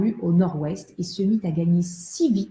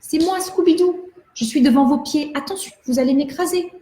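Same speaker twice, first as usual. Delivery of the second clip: little doll voice; 7 semitones higher than usual. Acted. Doll speaks from the ground.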